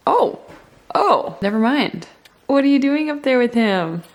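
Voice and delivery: loud surprised exclamation. Real, not acted. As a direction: caught off guard by the dog. Dog surprises her.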